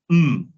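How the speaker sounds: loud, held hum on "Mm"; letter sound M. Shown as a demonstration. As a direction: say "Mm" as a pure hummed consonant. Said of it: "pero se pronuncia".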